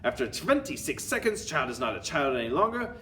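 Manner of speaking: theatrical villain witch laying out the scheme; Grand High Witch. Acted. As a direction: relish each step of the evil plan. Story villain's speech in character.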